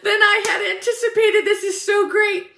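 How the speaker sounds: high-pitched, bubbling excitement; giddy five-year-old. Retold or imitated. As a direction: gush like an overexcited little kid. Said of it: "like a little five-year-old, so excited".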